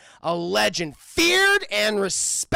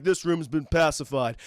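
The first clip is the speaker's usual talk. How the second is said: low-pitched tough-cop impression; Sylvester Stallone; 5 semitones lower than usual. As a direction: deliver it deep, like an action hero. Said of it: "I'll translate Stallone's".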